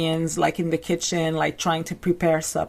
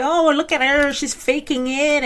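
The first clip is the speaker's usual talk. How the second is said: mocking critics; 8 semitones higher than usual. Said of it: "some people might say".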